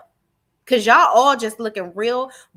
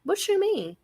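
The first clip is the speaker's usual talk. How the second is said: high-pitched probing question, a little softer; pointed mock questioning; 7 semitones higher, 4 dB quieter than usual. Host playing the questioner to Erica, pointed and high. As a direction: press for an answer in a raised pitch.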